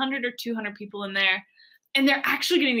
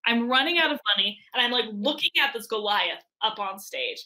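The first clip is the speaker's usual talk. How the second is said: ordinary delivery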